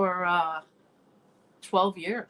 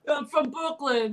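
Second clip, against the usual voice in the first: lightly mocked Brooklyn guy, higher and slow; mildly imitating a Brooklyn excuse-maker; 5 semitones higher than usual. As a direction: draw it out as a hint of a Brooklyn guy. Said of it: "It's a Brooklyn thing".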